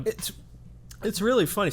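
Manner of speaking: talking through a mouthful; choking on granola mid-sentence; choking on food. Real, not acted. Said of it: "Sorry, I'm choking on my granola bar".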